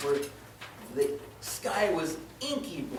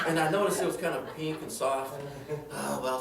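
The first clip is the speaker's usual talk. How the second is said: ordinary talk, nothing marked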